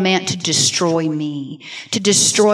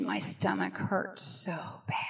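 whispered; 4 semitones lower, 14 dB quieter than usual. Retold or imitated. Injured daughter quoted on phone.

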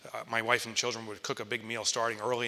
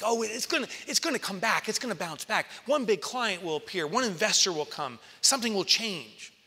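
much higher, airy self-reassurance; inner denial voice; 9 semitones higher, 4 dB louder than usual. Retold or imitated. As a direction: chirp hopeful lies to yourself. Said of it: "my mind was like".

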